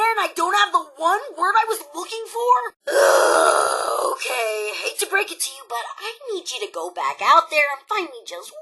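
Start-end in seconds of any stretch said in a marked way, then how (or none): none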